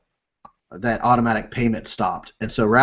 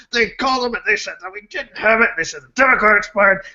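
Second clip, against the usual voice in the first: raised-pitch re-enacted tirade; angry rant; 7 semitones higher than usual. Husband venting about the insurance company, angry rant. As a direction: replay a furious rant in a pushed, higher voice. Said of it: "I was so angry".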